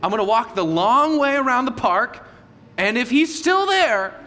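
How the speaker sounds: a hint of a pouting kid bargaining with God; sulky six-year-old. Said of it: "a six-year-old".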